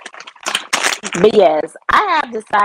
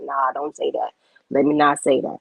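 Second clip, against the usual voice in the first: lower, restrained caution; lightly voiced Jesus; 6 semitones lower than usual. Streamer voicing Jesus cautioning her, lightly acted. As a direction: drop low, a gentle check.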